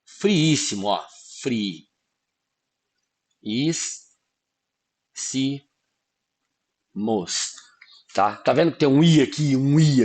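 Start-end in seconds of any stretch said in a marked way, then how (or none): 1.4-7.5 low, softer syllables, one at a time. Shown as a demonstration. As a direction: split into syllables with long pauses between. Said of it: "você precisa separar a sílaba".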